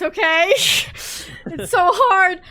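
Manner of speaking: real tears you laugh through; choked laugh, then the voice cracks; choking up. Real, not acted. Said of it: "Do you need a minute?".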